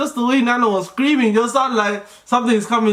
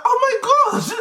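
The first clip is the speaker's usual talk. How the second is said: astonished; slower than usual. Retold or imitated. Partner sees the baby is born.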